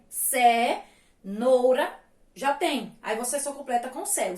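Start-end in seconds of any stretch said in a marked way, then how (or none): 0.1-2.0 "Cenoura" slow, with a gap after ce; first syllable set apart. Shown as a demonstration. Teacher showing the missing syllable, "Cenoura" broken after ce. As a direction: say "Cenoura" as ce, long pause, noura. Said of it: "Você vai completar com C E".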